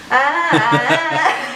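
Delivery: talking with tongue sticking out. Shown as a demonstration. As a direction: sound it out with tongue out. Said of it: "la langue sortie? Tu dois répondre comme ça".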